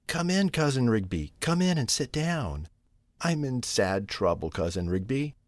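soft, understated sorrow in a storybook mother cat; mildly tearful. A narrator's reading. Troubled Tabitha welcomes Cousin Rigby in, mildly tearful. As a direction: welcome her in softly, with a touch of tears. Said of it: "said Tabitha, shedding tears".

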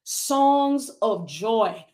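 Songs of joy stretched out very slow and lingering; slow repeated phrase. Shown as a demonstration. Preacher repeating the verse's promise to viewers, very slow. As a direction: savour "Songs of joy" slowly.

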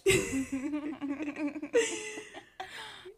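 helpless giggle before attempting an accent; giggling fit. Real, not acted.

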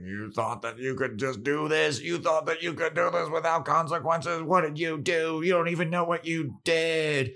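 high, far-off scolding demon voice, every line pitched well up; scolding demon Dawn. Retold or imitated. Demon Dawn scolding the gang for bringing Buffy back, in a high put-on voice. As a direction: berate them like an ancient angry demon. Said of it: "they're like".

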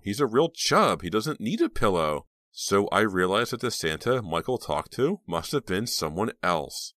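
a hint of an eager teen girl; teenage girl voice. Reva voiced in the story.

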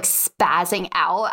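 ordinary talk, nothing marked

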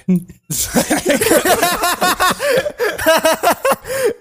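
a burst of high, loud laughter. Real, not acted. Laughing out loud after telling the teacher story. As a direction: crack up loudly at the story.

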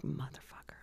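soft, breathy, awed curse under the breath; whispered curse. Retold or imitated. Reacting to her attorney's trick, whispered curse. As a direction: mutter it in stunned relief.

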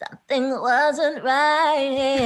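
slow, high sung line with creaky fry; breathy [name]-style fry; vocal fry. Retold or imitated. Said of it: "it's not pure vocal fry".

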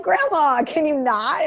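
high, loud mortified whine at grandma; embarrassed grandchild. Retold or imitated. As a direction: squeal it like a teen begging grandma to stop. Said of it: "I'm like".

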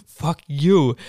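loud retold curse; mock-furious and punchy; angry outburst. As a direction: act out an angry comeback, loud. Retold fight, Bob cursing Willie.

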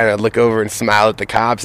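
ordinary delivery

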